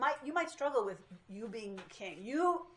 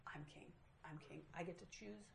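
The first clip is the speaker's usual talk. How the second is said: soft, low stubborn mutter; woman quietly defying God, soft and low; quiet stubborn insistence; 4 semitones lower, 19 dB quieter than usual. Retold or imitated. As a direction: insist under your breath. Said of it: "It's all about, I'm king here".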